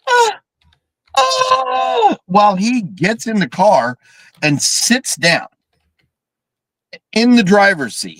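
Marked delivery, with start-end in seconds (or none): 0.1-2.2 very high mock squeal of panic; short squeal, then a longer high scream; mock helpless scream. Host imitates the girl in the car, high helpless scream. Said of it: "She just goes like this".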